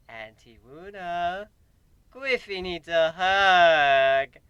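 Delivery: put-on slow, drawn-out little-kid whine; childish tone. Griffin begs Auntie Luna for a hug, childish. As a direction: ask for a hug like a sulky small child. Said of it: "I say with a childish tone".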